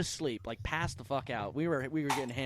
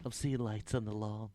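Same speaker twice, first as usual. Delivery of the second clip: softer, hushed, lightly voiced paranoid stoner; stoned friend on phone; 5 semitones lower, 7 dB quieter than usual. Host imitating a friend's paranoid late-night call, hushed. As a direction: murmur it like a spooked stoner. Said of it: "then he calls me up".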